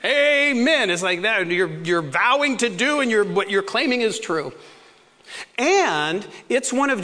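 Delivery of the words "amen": preaching call. Shown as a demonstration. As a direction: rouse the congregation. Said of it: "I often say at the end".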